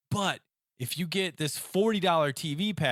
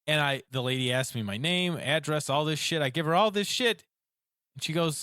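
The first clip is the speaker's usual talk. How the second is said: usual speaking voice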